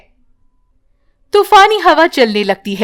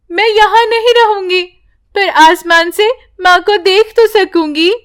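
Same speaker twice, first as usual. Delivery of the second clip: put-on higher child answering bravely; little girl's voice; 7 semitones higher than usual. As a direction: give up yourself for your mother.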